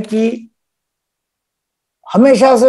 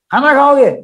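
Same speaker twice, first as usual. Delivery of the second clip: lightly acted host asking higher and louder; asking about dinner; 5 semitones higher, 3 dB louder than usual. Teacher plays someone asking a family member about dinner, lightly acted. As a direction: offer food as a friendly question.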